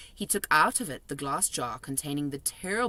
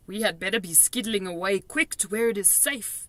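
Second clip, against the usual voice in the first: BFG's mock whisper, fully voiced; 3 semitones higher than usual. A narrator's reading. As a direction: voice the BFG hurrying someone away. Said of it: "the B F G whispered".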